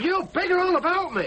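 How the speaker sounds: high, heated accusation; angry retort. Acted. Son turns on his parents.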